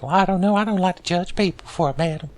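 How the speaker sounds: high-pitched ghost sidekick voice; Gower. Acted. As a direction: pitch it way up as an overfriendly neck-hugging ghost. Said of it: "What do you think, Gower?".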